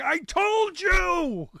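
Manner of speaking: high, loud, drawn-out mock scream; screamed I-told-you. Host imagining Orwell looking down on Operation Midway Blitz, mock screaming. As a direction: scream it slowly, as if vindicated from above.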